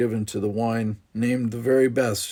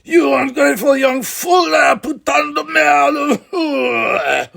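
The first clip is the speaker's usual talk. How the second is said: much higher, louder furious yelling; furious shout; 10 dB louder, slower than usual. Acted. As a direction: curse the ungrateful son. Father bellows at son.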